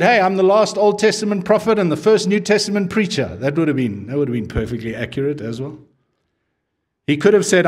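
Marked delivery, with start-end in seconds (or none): 0.0-3.4 raised, louder boast; a hint of showy pride; proud proclamation. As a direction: announce it proudly, up high and loud. Hypothetical proud John claim.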